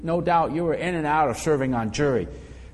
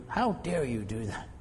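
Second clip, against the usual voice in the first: mild indignant rebuke; 2 semitones lower, 7 dB quieter than usual. Shown as a demonstration. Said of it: "you ever use the word".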